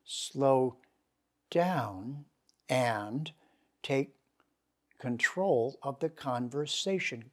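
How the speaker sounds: slowing down. Shown as a demonstration. Teacher showing students how to slow down with Sanskrit words. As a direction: let each word take its time. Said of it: "you've got to slow".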